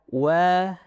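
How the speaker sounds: "waqamara" with thin wa, thick qa, thin ma, thick ra; thin-thick alternation. Shown as a demonstration. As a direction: alternate light and heavy syllables in "waqamara". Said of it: "Tipis, tebal, tipis, tebal".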